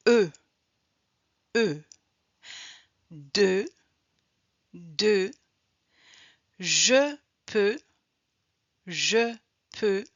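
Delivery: "de", "peux", "veux" on a held rounded EU vowel; isolated, carefully held EU in each word; teacher modelling EU, pause after each item; French EU sound drill. Shown as a demonstration. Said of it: "Voici quelques mots avec le son".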